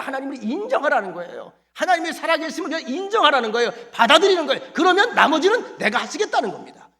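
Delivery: loud, high, ringing sermon cry; full-force preaching. Acted. Pastor urging the congregation to accept God, preaching loudly. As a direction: proclaim it to the whole congregation.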